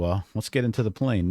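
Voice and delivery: ordinary talk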